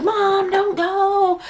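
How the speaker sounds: high, slow, whiny imitation of a crying kid; imitating crying child. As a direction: mimic a little boy begging through tears. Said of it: "he's crying and he's like".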